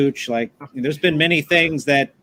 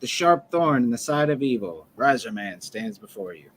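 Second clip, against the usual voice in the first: ordinary delivery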